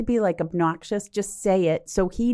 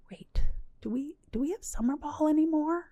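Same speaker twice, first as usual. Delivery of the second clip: lightly voiced kid; 9 semitones higher, 6 dB quieter than usual. Mother voices son in story.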